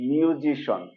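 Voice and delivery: "মিউজিশন" ending in one syllable shon; correct SHON ending. Shown as a demonstration. Teacher sums up the word, "মিউজিশন" ending in shon. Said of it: "তাহলে কি হলো?".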